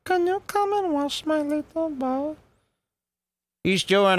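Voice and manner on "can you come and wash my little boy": put-on much higher, sweet little child voice, softer at the end; normal kid voice. Shown as a demonstration. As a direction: squeak it like a small boy calling his dad. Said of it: "He's not going".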